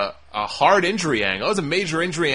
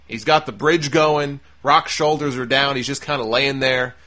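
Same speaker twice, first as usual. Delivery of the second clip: ordinary talk, nothing marked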